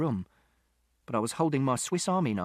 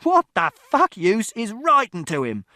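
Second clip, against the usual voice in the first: rising into a high, loud, slow furious yell; scornful shout; 9 semitones higher, 7 dB louder, slower than usual. A narrator's reading. Father scorning mother's letters, scornful shout. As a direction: scoff then explode. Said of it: "And Father shouted".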